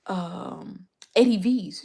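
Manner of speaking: usual speaking voice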